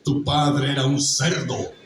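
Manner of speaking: cold contempt held back; a bitter slow insult, never shouting; Snape. Shown as a demonstration. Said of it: "cuando dice".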